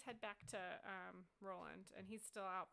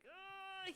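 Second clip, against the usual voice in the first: high exasperated groan; hammed-up high "ugh" of frustration; frustrated groan; 11 semitones higher than usual. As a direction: groan like you're at wit's end. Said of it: "he's so frustrated".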